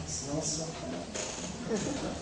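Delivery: half-hidden whispered joke; whispered aside. Real, not acted. Preacher whispering a joke to the congregation.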